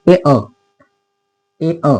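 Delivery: ए अ, two vowels sounded in turn; sounding out. Shown as a demonstration. Teacher sounds out the vowel for the class. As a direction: break the vowel into ए then अ. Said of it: "याचे उच्चार काय आहे?".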